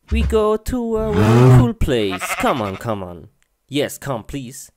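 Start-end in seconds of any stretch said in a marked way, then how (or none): 2.4-4.7 coaxing. Acted. Coaxing the cow.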